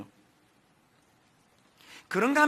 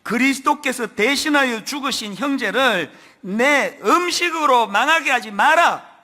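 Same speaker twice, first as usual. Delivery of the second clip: loud, raised, emphatic command from the pulpit; fearsome scripture warning; 4 semitones higher, 7 dB louder than usual. Acted. Preacher reading a fearsome verse to the congregation, loud stern warning. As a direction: proclaim it forcefully, building to a louder final command. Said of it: "무서운 말씀 나옵니다".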